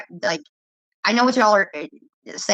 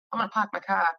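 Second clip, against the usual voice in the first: soft R-less East Coast "pahk my cah"; imitated East Coast accent; 5 dB quieter, faster than usual. Trying out friend's accent on a sentence, imitated East Coast accent. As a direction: drop every R like an East Coaster. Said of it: "You forget the rest of the word".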